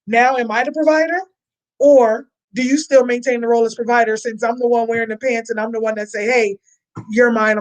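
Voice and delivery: ordinary talk, nothing marked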